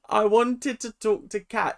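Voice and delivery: retry the New Zealand accent, hammed up; bad New Zealand accent. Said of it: "the worst New Zealand accent in the world".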